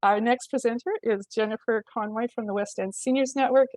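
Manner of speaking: ordinary talk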